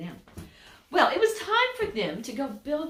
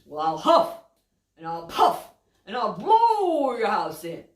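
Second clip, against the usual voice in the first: loud building wolf threat; 7 dB louder than usual. Acted. Wolf threatening the pig's house, loud. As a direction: huff and puff it big. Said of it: "He says".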